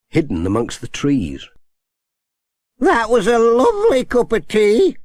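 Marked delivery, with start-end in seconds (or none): 2.8-5.0 put-on high, pleased old granny; granny savouring her tea; Granny Dryden. A narrator's reading. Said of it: "she said".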